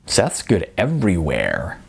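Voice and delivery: low, sly and smiling; devilish smile. Acted. Jasmine teasing Icon about Seth, low and sly with a smile. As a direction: tease it with a knowing smile. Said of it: "with a devilish smile".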